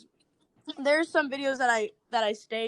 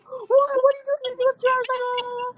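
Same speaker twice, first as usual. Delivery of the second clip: freaked out; 12 semitones higher than usual. Retold or imitated. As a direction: squeal it in retold shock. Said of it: "And he, like, freaked out".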